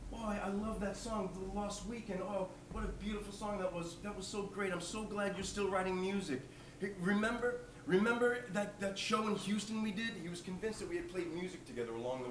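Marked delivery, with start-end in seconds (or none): 0.1-10.1 a hint of an enthusiastic old-timer; lightly voiced old man. Old man praises the singer's song, lightly voiced and gushing. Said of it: "he goes".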